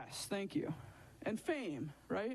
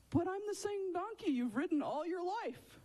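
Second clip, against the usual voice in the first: much higher, hammed-up donkey voice; protesting donkey; 9 semitones higher than usual. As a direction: argue back as a hurt talking donkey. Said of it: "You know what the donkey says back?".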